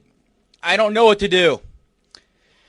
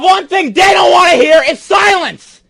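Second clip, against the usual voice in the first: higher yelling at his dad; furious yell; 7 semitones higher than usual. Real, not acted. Son yelling at his dad not to cut the mic, furious. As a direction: scream to stop him cutting the mic.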